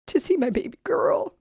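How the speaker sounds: whispered through tears; tearful whisper. Real, not acted. Mother asking nurse to see her baby, whispered. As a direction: barely get the words out.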